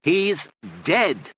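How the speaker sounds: loud, high, word by word; shout. A narrator's reading. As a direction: shout it straight at a deaf listener. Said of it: "and shouted".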